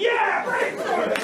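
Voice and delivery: hammed-up proud whoop; triumphant cheer. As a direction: crow it out like a victory. Triumphant cheer after punchline.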